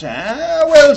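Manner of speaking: high, loud Irish lilt; Irish leprechaun. Retold or imitated. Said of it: "says the leprechaun".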